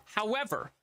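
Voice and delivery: hammed-up sponsor pitch; upbeat salesman voice; ad read.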